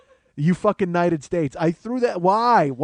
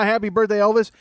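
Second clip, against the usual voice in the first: mocking parody; 4 semitones higher, 6 dB louder than usual. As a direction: parrot her words to ridicule her. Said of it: "everybody's like".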